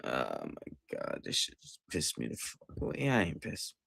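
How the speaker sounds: low, soft, half-voiced letdown; defeated murmur. Real, not acted. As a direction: let it die in your throat. Deflated after losing.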